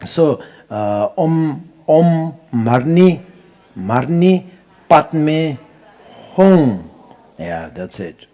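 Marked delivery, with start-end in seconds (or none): 1.9-6.9 "Om Marni, Padme, Hum" said slowly and higher, each word separated, "Hum" loud; careful mantra. Shown as a demonstration. Teacher demonstrating careful mantra pronunciation, slow and emphatic. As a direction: split and stress each syllable. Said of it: "very important to have a good pronunciation".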